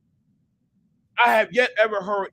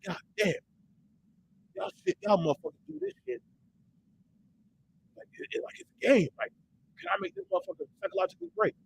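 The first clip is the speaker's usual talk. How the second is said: put-on soft, partly whispered grumble to the kids; exasperated muttering; 9 semitones higher, 7 dB quieter, faster than usual. Tired father grumbling at his kids over homework. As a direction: complain under your breath. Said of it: "I'm like".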